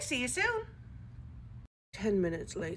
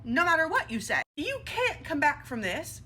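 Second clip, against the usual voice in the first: ordinary delivery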